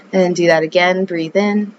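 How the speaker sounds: calm yoga-teacher voice; guided meditation. Acted. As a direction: ease the listener into the breath, unhurried.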